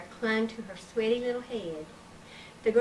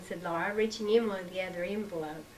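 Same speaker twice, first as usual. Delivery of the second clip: usual speaking voice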